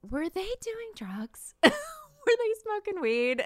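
high-pitched, baffled mock query; incredulous question. Podcast host wondering about the birth stories, high incredulous voice. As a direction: ask it high, half laughing in disbelief. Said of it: "but I'm like".

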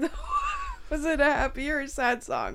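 laughing through a teasing question; laughing tease. Real, not acted. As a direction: tease him while still giggling. Teasing him about [name].

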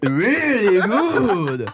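slow laughing high voice; drawn out through laughter; laughing mock review.

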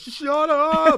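very high, loud mock shriek; mocked girlfriend yell. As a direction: squeal it as a fed-up ex. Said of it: "And she's like".

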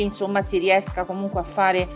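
ordinary talk

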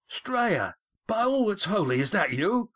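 high, amazed call up to a ship; astonished hail. A narrator's reading. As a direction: call up in disbelief, voice pitched high. Boatman hails Estrella up on ship.

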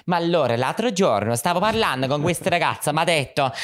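high, lilting Italian phone chatter; mimicking a hot Italian guy on the phone, high and sing-song. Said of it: "You could just hear him being like".

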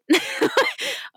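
squeaky burst of laughter; high laugh. Real, not acted. Laughs at her own reaction.

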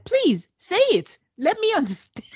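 a wife urging her husband to speak, mock pleading; pleading wife imitation. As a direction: beg him to speak, half-laughing. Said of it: "Bao was like".

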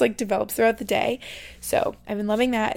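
ordinary talk

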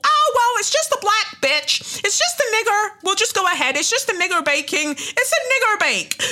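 high, loud sneering mock voice; sarcastic high-pitched dismissive impression. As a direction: parody the careless contempt. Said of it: "and you thought".

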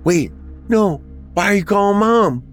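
high-pitched anxious protest; gasped alarm. A narrator's reading. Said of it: "Bobby gasped".

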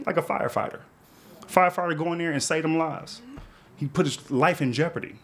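normal talking voice

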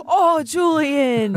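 gasp, then a long drawn-out groan of sympathy; sympathetic dismay. Real, not acted. Host hears caller's broken ankle, dismayed sympathy. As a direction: draw it out high, half laughing.

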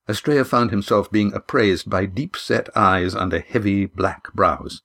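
ordinary delivery, nothing marked

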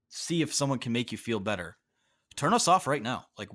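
ordinary talk

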